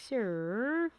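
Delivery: usual speaking voice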